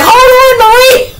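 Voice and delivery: imitating a high-pitched holler; panicked mock call. Retelling a man calling to a woman in the wind, high mock holler. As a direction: call out high and loud, hammed-up.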